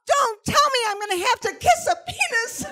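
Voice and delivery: very high, loud mock wail; hammed-up shriek of an appalled kid; horrified child's cry. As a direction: cry out in exaggerated horror. Horrified daughter's cry in retold story.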